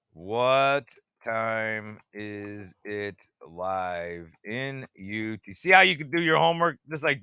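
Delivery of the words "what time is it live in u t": very slow, lower, stretched question; slowly muttered to himself; drawn-out musing. Real, not acted. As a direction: drag each word out while thinking. Asks the time twice, drawn out.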